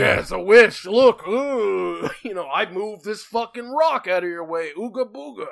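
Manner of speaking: loud, boastful caveman-era genie. Pretend. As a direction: brag loudly like a primitive god. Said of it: "caveman-era genie boasting".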